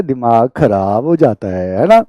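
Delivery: teasingly slow recitation; drawn-out mock lesson. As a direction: draw each word out as if prompting a class. Mock-teaching the debunked lesson to students.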